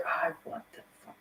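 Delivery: breathy whisper, barely voiced; whispered disbelief. Remembering the mind's reaction to the vision, whispered. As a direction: let it slip under your breath. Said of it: "my mental was like".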